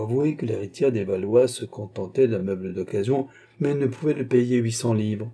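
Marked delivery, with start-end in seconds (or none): none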